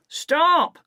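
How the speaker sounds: very high loud yell; a chasing smuggler's shout. A narrator's reading. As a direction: bark it after the boy. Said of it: "Mac shouts".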